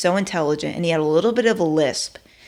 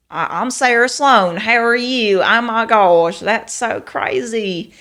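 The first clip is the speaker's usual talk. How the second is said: higher, louder Southern drawl; Texas country accent; 5 semitones higher, 5 dB louder, slower than usual. Shown as a demonstration. Showing the accent she could have picked up, Texas drawl. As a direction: put on a big small-town Texas accent. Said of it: "I could talk like that easily".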